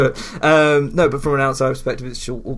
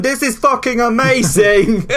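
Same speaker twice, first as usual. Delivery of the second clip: much higher and louder, slow and thrilled; teen excitement; 11 semitones higher, 8 dB louder, slower than usual. Retold or imitated. Imitating his fifteen-year-old self, thrilled and shouting. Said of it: "fifteen-year-old me going".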